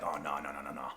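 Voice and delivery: low, hushed string of no's; retold dread. Tourist dreading death underground, murmured low. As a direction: murmur it to yourself.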